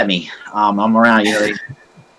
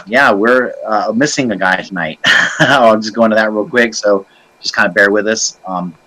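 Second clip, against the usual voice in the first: normal talking voice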